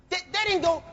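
ordinary talk, nothing marked

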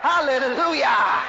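real loud, drawn-out praise; ringing preacher's hallelujah; exultant shout. Real, not acted. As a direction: shout it long and joyfully. Preacher exulting after the hail.

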